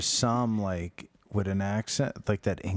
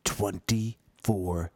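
mock announcer voice; 4 semitones lower, much slower than usual. S1 voicing the announcer, echoing.

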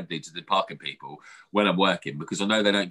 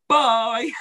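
high, louder sing-song bye; mock sweet bye; 13 semitones higher, 5 dB louder than usual. Brushing off the warden with a smug bye. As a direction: toss off a smug goodbye. Curt dismissive farewell.

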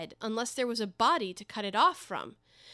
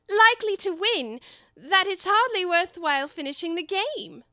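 high, loud, flustered Alice; loudly change course as the Queen overhears; hasty cover-up; 9 semitones higher, 8 dB louder than usual. Acted. Alice flattering the Queen who stands behind her, loud and high.

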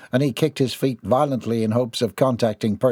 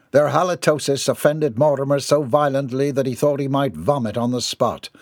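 plain reading voice

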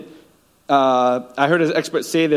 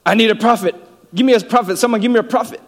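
crying out; 7 semitones higher, 4 dB louder, faster than usual. Retold or imitated. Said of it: "I'm sure God is crying out".

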